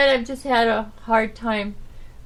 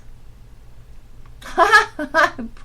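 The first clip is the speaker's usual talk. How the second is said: real laugh; 7 semitones higher than usual. Real, not acted. Daughter's remark makes him laugh.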